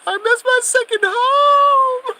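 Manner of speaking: high, tearful mock wail, drawn out slowly; mock crying wail. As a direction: wail it like happy tears at coming home. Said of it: "I'm gonna be crying".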